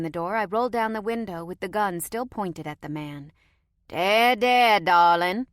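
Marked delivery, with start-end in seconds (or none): 3.9-5.5 louder, drawn-out Cajun sweet talk; heavy Cajun accent. A narrator's reading. Cajun stranger soothes the woman at the window in Cajun accent. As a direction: Cajun charm, coaxing. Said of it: "in a heavy Cajun accent".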